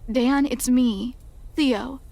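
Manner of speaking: ordinary delivery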